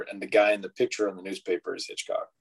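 ordinary talk

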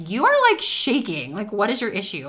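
husband taken aback by her shaking; a mock-alarmed husband, sharp up front; startled husband. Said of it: "my husband was like".

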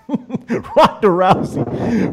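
drawn-out name through a laugh; real laughter breaking up the words. Real, not acted. Laughing as he names her.

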